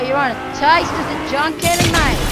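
mock the opponent; gleeful taunt. Real, not acted. Taunting the enemy while firing.

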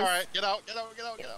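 rising, high-pitched get out, get out; high urgent calling. Real, not acted. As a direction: hurry them out in a high voice. Urging a teammate out of the house.

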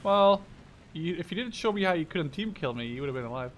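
usual speaking voice

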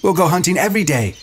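high-pitched eager gushing; thrilled. A narrator's reading. Jack urging Ralph to share the hunt, thrilled. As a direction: rush on, high and keen.

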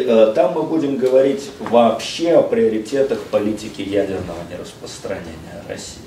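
ordinary talk

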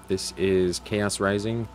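ordinary delivery

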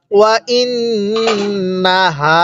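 ওয়া ইন্নাহা slowly, the doubled ন hummed through the nose; with ghunnah. Shown as a demonstration. Teacher demonstrating ghunnah to students, slow nasal recitation. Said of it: "কিভাবে পড়ব?".

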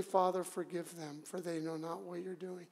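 hushed, low prayer; forgiving prayer. Acted. After listing his wounds, praying for his executioners, hushed. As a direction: drop to a soft, low murmur of pardon. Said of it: "I'm gonna say".

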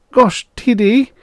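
high, slow, loud protest; disapproving assistant. A narrator's reading. Assistant objects to his boss, high and disapproving. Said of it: "Frick frowned disapprovingly".

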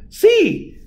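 very high squeak of joy; delighted yes. A narrator's reading. Mouse agreeing to the plan, delighted. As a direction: squeal it happily.

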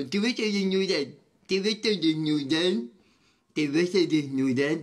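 lightly distorted mock repeat of "Te vas a desnudar"; mocking parrot voice. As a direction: tease the comment by parroting it. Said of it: "Y yo".

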